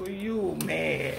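ordinary delivery

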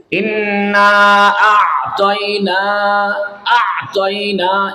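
আ'তাইনা held long and high, squeezed in the throat; slow, drawn-out Quranic আ'তাইনা; throat-pressed recitation. Shown as a demonstration. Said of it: "গলার ভিতরে আ চাপ দিলাম".

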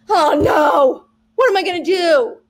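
loud, high hammed-up alarm; a hammed-up cry of dismay; mock panic. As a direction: play the panicked student, over the top. Teacher mocks students' panic.

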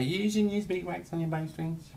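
high, nagging mock voice; mocking what people say. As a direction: parrot the know-it-alls. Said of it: "People say".

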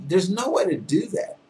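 ordinary delivery

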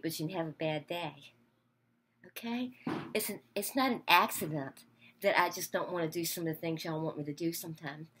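ordinary talk

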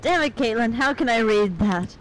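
usual speaking voice

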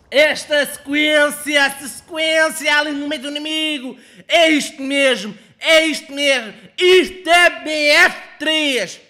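loud, high hype over a kill streak; excited shouting. Real, not acted. Gamer hyping his own kill streak, shouting loud. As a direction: bellow it like a stadium celebration.

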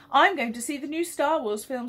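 put-on high, sharp snap of a cross boy; a touch of a sulky boy snapping at his parents; snapped. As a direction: bite the words off at his family. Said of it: "snapped Liam".

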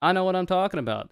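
usual speaking voice

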